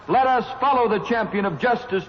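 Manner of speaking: usual speaking voice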